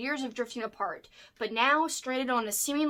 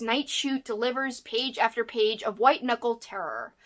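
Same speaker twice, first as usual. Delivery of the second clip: ordinary talk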